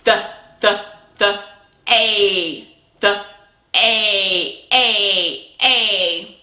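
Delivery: "The" then long drawn "a" sounds; stretched sounds. Shown as a demonstration. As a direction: segment the word, ending on "a". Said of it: "Here we go".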